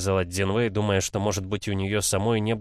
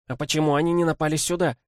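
defiant; 8 semitones higher, faster than usual. A narrator's reading. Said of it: "спросила Цзиньвэй с вызовом".

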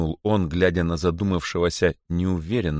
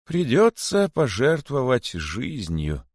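slow, resigned and sad; sad, simple; 7 semitones higher, slower than usual. A narrator's reading. Old rabbit telling his disciple he must die, slow and sad. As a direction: say it plainly, accepting fate. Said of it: "печально и просто сказал задумавшийся".

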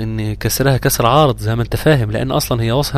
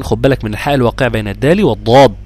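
ordinary delivery

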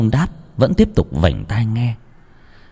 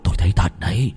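frightened telling; 2 semitones lower than usual. Acted. Husband describing ghost.

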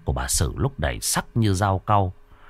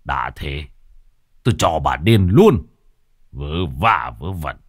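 grumbling; 5 semitones lower than usual. Acted. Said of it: "Sau đó cằn nhằn".

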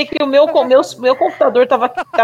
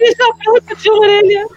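laughing; 10 semitones higher than usual. Real, not acted. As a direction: say it while cracking up. Laughing at the costume.

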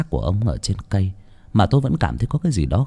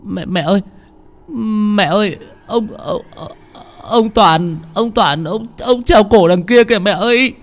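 put-on panicked child's cry; 11 semitones higher than usual. A narrator's reading. Child telling mother of a hanged man, panicked cry. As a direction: stammer in fright, pitched high. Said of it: "rồi kêu lên".

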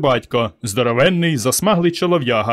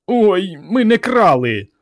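high, slow tearful wail; high-pitched sniveling protest; boy whimpering; 7 semitones higher, 3 dB louder, slower than usual. A narrator's reading. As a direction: whine the denial, drawn out. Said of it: "рюмсав Іван".

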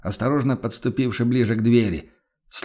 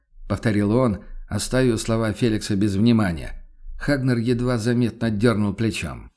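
plain reading voice

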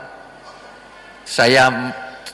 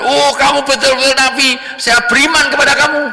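high, loud burst of acted belief; hammed-up awestruck declaration; amazed exclamation; 12 semitones higher, 4 dB louder than usual. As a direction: cry out as if witnessing a miracle. Acted amazed convert after miracle.

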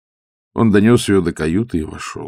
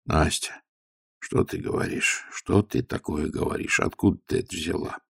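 pleading; 8 dB quieter than usual. A narrator's reading. Old father quietly pleading with his raving daughter. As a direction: beg her quietly to stop. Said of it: "умоляюще произнёс".

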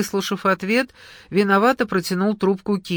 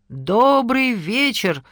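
slow, louder "good evening"; loud greeting; 5 semitones higher, 4 dB louder, much slower than usual. A narrator's reading. Elka greeting the husband across the room, loud and slow. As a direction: call out so the man on the phone hears. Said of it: "громко, чтобы Саша слышал".

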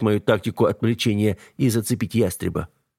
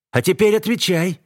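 demanding; 10 semitones higher, 3 dB louder than usual. A narrator's reading. Eva demands an answer.

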